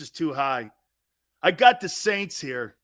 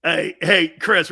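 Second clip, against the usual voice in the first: ordinary talk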